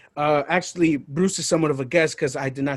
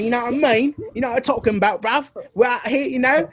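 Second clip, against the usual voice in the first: put-on British accent; 7 semitones higher than usual. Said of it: "not offended with my accent".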